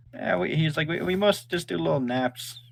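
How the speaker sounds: a small dragon thanking politely; young dragon voice. Acted. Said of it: "He goes".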